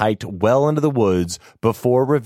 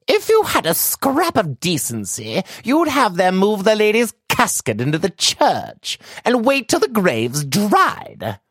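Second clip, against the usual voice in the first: much higher, reproachful matron; stern old lady's maid; 14 semitones higher than usual. Acted. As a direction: scold your employer with cold dignity. Stern maid rebukes master.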